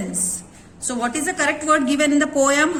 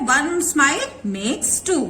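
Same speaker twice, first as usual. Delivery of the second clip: slow poem reading; much slower than usual. Shown as a demonstration. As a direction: read the poem line slowly, word by word. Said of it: "हमारे poem में बताया गया है". (धीमे से कविता की पंक्ति पढ़ना)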